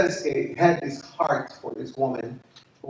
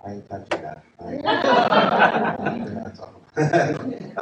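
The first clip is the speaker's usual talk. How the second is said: laughing refusal; 5 semitones lower, 5 dB louder, slower than usual. Retold or imitated. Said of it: "And I go".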